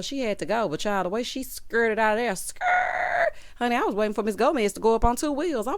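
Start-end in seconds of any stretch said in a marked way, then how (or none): 2.4-3.3 high drawn-out car skid noise; tire screech. Retold or imitated. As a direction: imitate tires peeling out with your voice. Imitates car screeching away.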